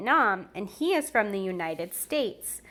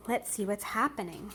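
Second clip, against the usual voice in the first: put-on lively read-aloud for children; storybook reading; 4 dB louder than usual. A narrator's reading. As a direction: read it like a bedtime picture book. Reading a picture book aloud.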